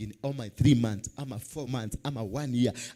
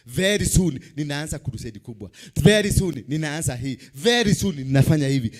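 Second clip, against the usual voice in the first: tv preacher; 8 dB louder than usual. Acted. Building preaching.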